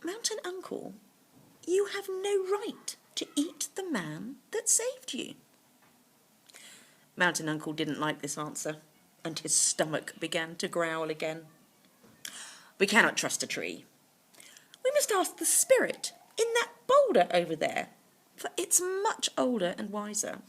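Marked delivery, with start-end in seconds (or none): 0.0-5.4 higher, airy voice; lightly voiced wise tree spirit. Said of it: "and replied". 12.8-20.4 grumpy tiger, a hint of scheming. Tiger argues for another judge.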